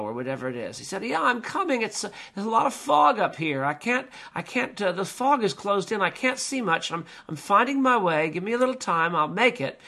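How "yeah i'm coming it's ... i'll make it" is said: high-pitched calling down from above; calling back. Retold or imitated. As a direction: act a climber calling down through fog. Climber above calls back down.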